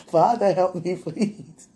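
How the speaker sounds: slow, pleading words through giggles; laughing fit while begging for help; giggling helplessly. Real, not acted. Overcome with holy laughter, begging the Father for help. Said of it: "The more I laugh, the more high I get".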